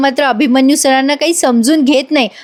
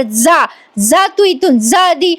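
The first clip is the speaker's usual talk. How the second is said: slow, higher mock yell telling her to leave; mock shouting; 4 semitones higher, slower than usual. Madam shouting at Ragini to leave, a mild mock yell. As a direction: imitate the old madam chasing Ragini off. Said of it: "त्या ओरडतात रागिणीवर".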